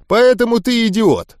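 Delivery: plain reading voice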